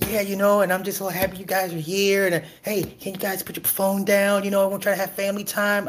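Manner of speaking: high, gentle mock dad voice; mock Travis. Travis asking for family time, high and gentle. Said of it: "He's on the dinner table like".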